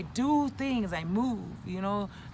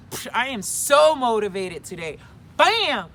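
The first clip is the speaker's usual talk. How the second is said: pumped-up; 10 dB louder than usual. Acted. Vlogger rating her motivation for viewers, acted pumped-up. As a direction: hype yourself up, hitting SO hard. Acts out being highly motivated today.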